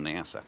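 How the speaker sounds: nasa said as NASS-uh; nasa, first vowel like cat; short-a pronunciation. Shown as a demonstration. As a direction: settle on nasa with a front a. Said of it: "Is it nasa or nasa?".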